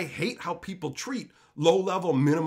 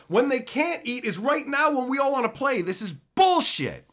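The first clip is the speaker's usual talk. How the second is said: loud, high, indignant rant; angry outburst; 9 semitones higher, 4 dB louder than usual. Acted. Telling staff off over a late tournament, angry outburst. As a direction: shut the excuse down at full volume.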